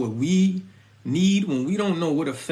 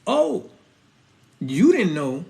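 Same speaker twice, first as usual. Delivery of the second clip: normal talking voice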